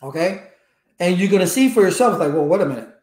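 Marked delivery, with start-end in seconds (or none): none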